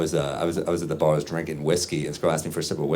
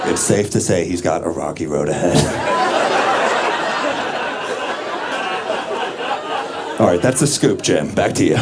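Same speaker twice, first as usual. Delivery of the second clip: higher, louder, punning like a smug TV anchor; smarmy news anchor; 3 semitones higher, 3 dB louder than usual. Retold or imitated. Newscaster signing off to Jim, mock smarmy. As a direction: ham up a cheesy newscaster's pun. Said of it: "smarmy anchor who's trying".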